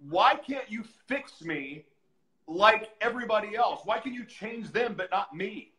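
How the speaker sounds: high, pleading accusation with loud bursts; yelling at God. Retold or imitated. Inmate blaming God for not changing them, angry yell. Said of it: "I'm yelling at, I'm blaming God".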